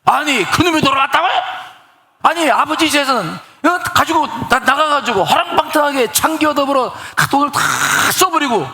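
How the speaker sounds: high-pitched indignant ranting; resentful son raging that his wayward brother came home; elder son's outrage. Acted. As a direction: spit it out like a furious older brother. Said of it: "속에서 막 분노가 올라".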